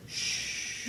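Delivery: unvoiced hush, barely opening one eye; a sly whispered shush; shushing hiss. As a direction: shh him quietly so the buzzards land. Rooster shushes farmer.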